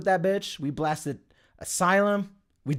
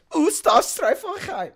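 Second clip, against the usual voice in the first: much higher, slow mock-German word; fake German; 12 semitones higher, 4 dB louder, slower than usual. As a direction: squeal it in a jokey German accent. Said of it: "just fucking with the accent".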